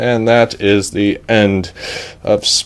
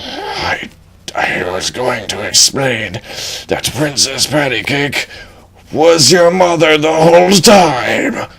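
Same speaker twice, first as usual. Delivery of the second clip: dragon reveal; 4 semitones higher than usual. Acted. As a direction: hush it then unveil the twist.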